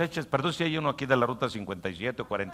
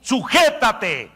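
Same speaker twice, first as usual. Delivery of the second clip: higher, louder, slow stern commands; commanding shout; 10 semitones higher, 9 dB louder, slower than usual. Acted. Fasting silencing the inner voice, stern shouted commands. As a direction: shout down the craving voice. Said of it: "Le dice".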